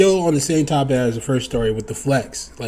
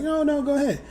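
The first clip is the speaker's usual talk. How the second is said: polite offer; 11 semitones higher, 5 dB quieter, faster than usual. Retold or imitated. Politely letting couple pass.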